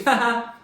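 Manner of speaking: loud, high gleeful laugh; teasing laugh. Retold or imitated. Laughing at a teased sibling, loud and high. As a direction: burst out with a high mocking laugh.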